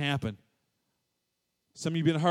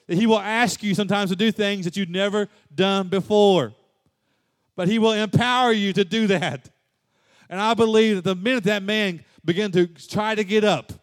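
higher, louder, rising sermon climax; fervent preacher building to a peak; preaching at full force; 7 semitones higher, 5 dB louder than usual. Acted. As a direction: preach it to the rafters.

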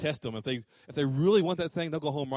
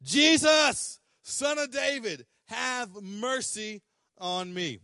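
crying out; 6 semitones higher, much slower than usual. Retold or imitated. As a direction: voice a blind man's desperate cry for mercy. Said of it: "he began to shouting".

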